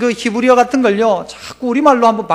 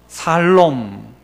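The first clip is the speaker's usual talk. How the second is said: drawn out; 8 semitones lower, 3 dB quieter, much slower than usual. Shown as a demonstration. Drawn-out Korean word play.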